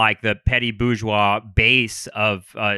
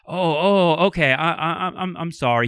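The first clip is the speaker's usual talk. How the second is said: meek stammer; 6 semitones higher than usual. Retold or imitated. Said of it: "kind of a meek guy".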